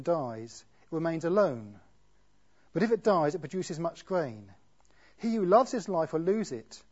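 solemn scripture read from the pulpit. Acted. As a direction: read the words of Jesus with weight. Reads the Bible passage aloud.

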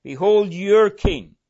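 loud, slow proclamation leaning on "your"; stress on your. Shown as a demonstration. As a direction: hit "your" hard, high and drawn out. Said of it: "the emphasis being on your".

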